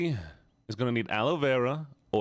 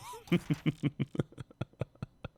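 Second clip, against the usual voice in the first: softer, higher chuckle that keeps going; real chuckling; 4 semitones higher, 5 dB quieter than usual. Real, not acted. Streamer teasing chat about spite, drawn-out chuckling. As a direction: chuckle on at your own remark.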